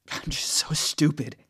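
high, slow, breathless whisper; exhausted tearful murmur; defeated whisper. A narrator's reading. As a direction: barely get the words out. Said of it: "is all I can muster".

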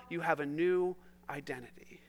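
cichy szept; soft whisper. Pretend. David voicing God's words.

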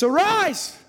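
high, rising-then-falling cry of ARISE; ringing pulpit call; preacher's cry. Acted. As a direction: cry out the command to the congregation. Preacher cries out the command.

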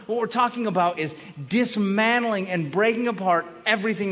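loud, rising preacher's build; fiery sermon crescendo; preaching at full force. Acted. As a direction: thunder it from the pulpit.

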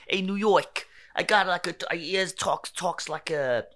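bad New York accent. Shown as a demonstration. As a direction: Englishman badly faking a New Yorker. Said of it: "which is exactly what I'm doing right now".

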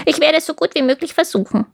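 fast high crow voice; raspy cawing crow. Acted. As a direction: rattle it off like a crow. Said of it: "sagte die Krähe".

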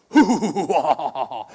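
loud, hammed-up villain laugh; cartoon villain cackle, hoo hoo hoo; Scooby-Doo bad guy laugh. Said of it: "then I'll do this laugh".

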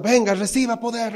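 mock faith healer. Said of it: "Porque yo estoy cansado".

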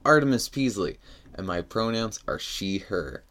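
loud, upbeat radio host opening; radio announcer. Acted. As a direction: announce it big like a DJ.

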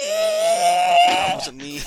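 high drawn-out screeching cry. Pretend. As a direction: let out a wild screech. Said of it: "That was just a screech".

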